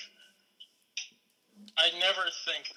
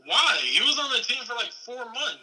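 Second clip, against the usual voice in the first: higher, louder burst of disbelief; can't believe he bought that; incredulous why; 7 semitones higher, 5 dB louder than usual. Real, not acted. Disbelief at owning the jersey.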